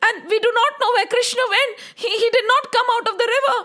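high, very fast, stammering report; panicked child. Acted. Children tell Yashoda about the serpent, panicked. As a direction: blurt it out through tearful panic.

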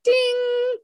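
high, ringing sung-out "ding"; imitated bell ding. Comic telling the host of a realization, imitated ding. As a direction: sing it out like a little bell.

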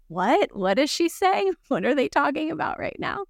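high-pitched mock confusion; baffled listener. Host imitating lost listeners hearing about Yoga Nidra, mock bafflement. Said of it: "people listening who are like".

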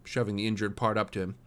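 ordinary delivery, nothing marked

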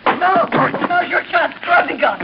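high, loud frightened cry; high alarmed shout of warning. Acted. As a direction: warn him in sudden fright. Woman warns of drawn gun.

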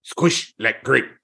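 slow put-on sensei voice; drawn-out broken-English film sensei; Mister Miyagi. Co-host finishing Miyagi's warning to Daniel, slow imitated sensei voice. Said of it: "scene from Karate Kid where Mister Miyagi".

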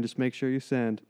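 ordinary delivery

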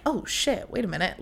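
soft, dawning aside; hushed realisation. Retold or imitated. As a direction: drop the volume as it sinks in. Retelling her sudden private realisation.

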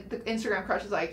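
ordinary talk

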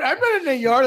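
high, whiny mimicry of a denial; mock a driver protesting innocence. Truck driver denying he's in the yard, mocking protest.

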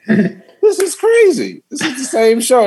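very high, drawn-out incredulity; high-pitched disbelief. Retold or imitated. Comic reacts to seeing the same show twice, high incredulous squeal. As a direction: squeal it in amused, incredulous exasperation.

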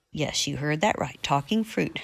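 ordinary delivery, nothing marked

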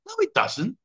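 high, mock-indignant retort; mock indignant squeak. Real, not acted. Host answering viewer's silly sum, mock outraged squeak. Reacting to joke 2+2=5.

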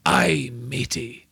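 slow, whispered hammed-up pirate. Co-host delivers his pirate joke punchline in a slow, whispered mock pirate voice. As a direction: drag it out in a hushed pirate voice. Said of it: "I get really into them".

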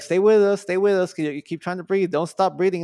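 paramedics urging. Retold or imitated. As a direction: imitate a paramedic calling him back.

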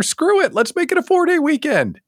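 high gleeful outburst; excited. Acted. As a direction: cheer them into skipping work. Gleeful call to skip work.

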